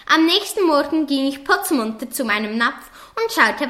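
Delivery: ordinary delivery, nothing marked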